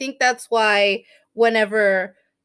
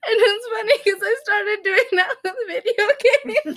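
high voice squeezed by laughter; words bubbling out through giggles; laughing while talking; 10 semitones higher than usual. Real, not acted. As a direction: tell it while cracking up. Cracking up over own funny story.

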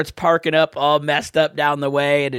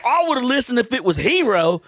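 higher-pitched mock Southern patriot; Southern drawl; 7 semitones higher than usual. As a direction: put on a proud Southern good old boy. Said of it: "issues with people with Southern draws".

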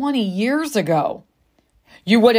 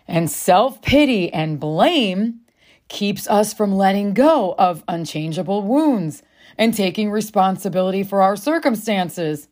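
normal talking voice

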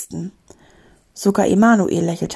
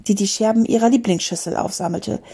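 usual speaking voice

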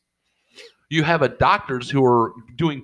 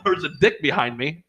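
usual speaking voice

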